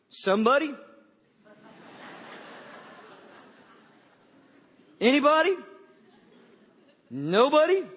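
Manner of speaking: put-on child voice puzzling through the door, building up; little kid's voice. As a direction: play a small child mishearing the name. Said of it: "This little voice said".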